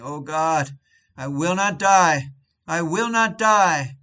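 loud, slow, anguished refusal to die; desperate cry. Retold or imitated. Dying wicked man crying to God, loud anguished refusal. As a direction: cry out against death, pleading to God. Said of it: "always shrieking".

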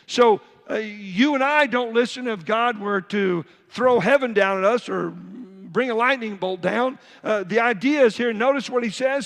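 preacher building to a high, loud climax; full-force preaching. Acted. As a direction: drive the point home to the congregation.

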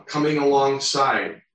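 normal talking voice

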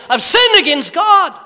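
high, loud cry of guilt; anguished cry. Acted. David confessing to Nathan, high loud cry. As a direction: cry it out, broken by sin.

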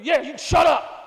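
high loud mock snap; preacher voicing his wife telling the kids to shut up, loud; wife snapping at kids. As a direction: bite back at the kids as the sick wife. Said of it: "My kids will tell you".

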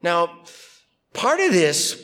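usual speaking voice

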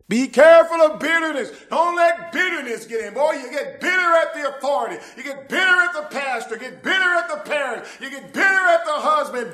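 high, loud, fiery preacher's cry; a pulpit shout that rises and rises; full-force preaching. Acted. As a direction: preach it hard to the back pew.

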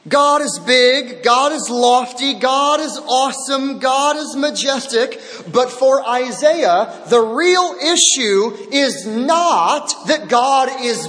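rising sermon proclamation; emphatic preaching. Acted. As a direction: declare each line like a herald.

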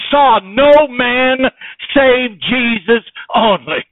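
loud, high ringing sermon voice; preacher building to the climax; fervent preaching. Real, not acted. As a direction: drive it home to the congregation. Preacher at full force.

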